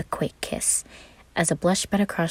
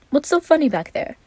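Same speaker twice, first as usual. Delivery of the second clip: lightly voiced Roman; 11 semitones higher, 3 dB louder than usual.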